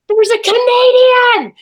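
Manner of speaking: high, loud drawn-out cry of discovery; imitated scream. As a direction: scream it slowly across the street like an amazed spectator. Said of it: "And then somebody screams".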